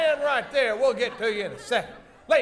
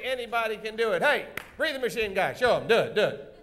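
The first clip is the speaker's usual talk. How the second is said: usual speaking voice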